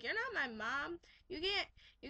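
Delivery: mock bratty boy. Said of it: "he's like".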